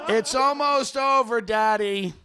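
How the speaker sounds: high, slow imitation of coaxing; son urging father. Son to dazed father during signing, mock coaxing. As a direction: stretch it out like calling to a confused parent. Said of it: "his son would look at him and go".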